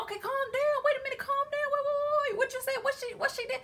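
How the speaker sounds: very high, rapid-fire interruption; flustered calming. Retold or imitated. Woman trying to calm the upset man down, high and flustered. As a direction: squeal over someone to settle them down. Acts herself calming him down.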